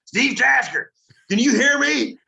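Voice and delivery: high, loud Southern Texas drawl calling out; a hammed-up Southern Texas producer voice; Southern Texas accent. Southern Texas producer calling Steve over the headset, accent. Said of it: "in Southern Texas".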